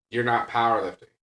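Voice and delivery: normal talking voice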